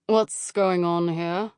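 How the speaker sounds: slow, stern teacher's challenge. Acted. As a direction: demand it like a strict teacher. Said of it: "the stern voice behind us".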